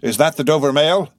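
loud, high called question rising on "mail"; calling out. A narrator's reading. Rider calling from the mist to the coach, shouted question. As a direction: call it across a distance. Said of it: "a man's voice called from the mist".

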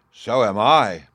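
emphatic loud reply; loud agreement. Acted. Wife answers the thirsty giant, loud and emphatic. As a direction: chime in loudly with the complaint.

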